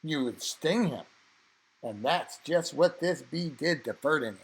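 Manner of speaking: ordinary delivery, nothing marked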